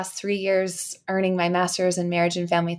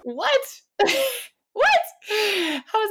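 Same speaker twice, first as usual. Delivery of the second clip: shocked; much slower than usual. Real, not acted. Shocked at leaving news.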